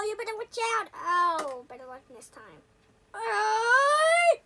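ordinary talk

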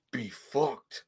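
low, soft gruff reply; lightly mock tough guy; put-on voice. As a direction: toss it off in a rough voice. Imitating movie character.

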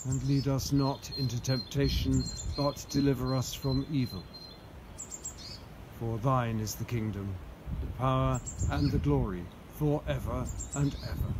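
solemn recited prayer. Acted. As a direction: intone it steadily.

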